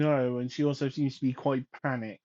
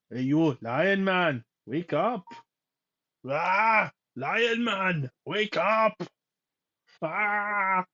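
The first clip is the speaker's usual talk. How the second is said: put-on Arnold Schwarzenegger style German accent, slow; Arnie accent; 4 semitones higher, 3 dB louder, much slower than usual. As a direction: play it like Arnie waking a friend. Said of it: "[name] suddenly becoming like, um, Arnie".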